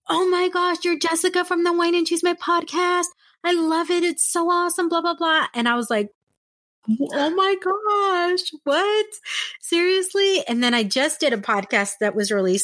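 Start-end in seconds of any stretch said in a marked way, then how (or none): 0.1-5.5 high-pitched imitation of a thrilled fan; gasp-then-gush, held up high. Fan messaging the podcast host on Instagram, gushing. 7.1-10.4 high, drawn-out retold astonishment; amazed disbelief. Podcaster replaying reaction to a fan, amazed.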